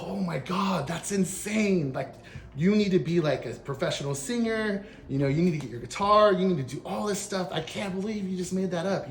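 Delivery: high-pitched, retold awe; amazed gushing. Telling how he praised his friend's song, amazed. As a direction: gush in disbelief, pitched well up.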